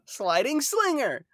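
high, slow mock attack shout; anime attack call. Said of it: "Xenoblade attacks are wild".

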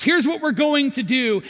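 a fired-up King David taking charge; angry king. Acted. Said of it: "He's angry. He's mad".

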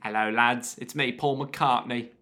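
low mock Liverpool accent. Beatle greeting the lads, low Scouse impression. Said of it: "what's [name]'s voice?".

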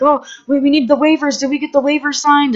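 hammed-up high-voiced fussing; mock fretting. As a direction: imitate a flustered colleague worrying over paperwork. Producer fretting about waivers, acted out.

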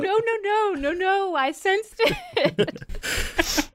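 slow, high, drawn-out mystic claim. Acted. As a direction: stretch it like a seer. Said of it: "I can sense that".

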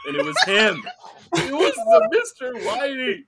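high, cracking-up reveal; laughing punchline. Real, not acted. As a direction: squeal the reveal through laughter. Punchline told through laughter.

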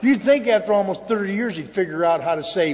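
ordinary delivery

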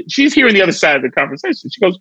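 normal talking voice

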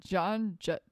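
ordinary talk, nothing marked

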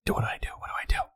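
very fast unvoiced whisper; panicked whisper. Customer secretly asking his partner what to say, rapid whisper. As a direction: whisper urgently for help.

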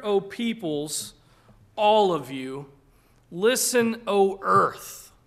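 forceful scripture reading. Acted. Pastor rereading the prophet's call, loud proclaiming. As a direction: preach it out to the whole earth.

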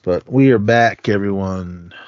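ordinary talk, nothing marked

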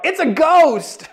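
high, drawn-out cry of fright; stretched, high-pitched frightened outburst; fearful cry. Acted. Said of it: "In their fear, they cried out".

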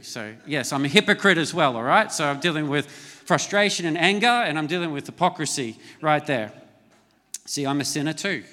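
usual speaking voice

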